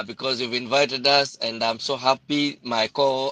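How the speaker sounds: ordinary delivery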